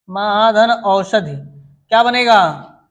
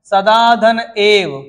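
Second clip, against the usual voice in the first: slow dictation; 4 dB louder, much slower than usual. Shown as a demonstration. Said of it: "teacher dictating sandhi example".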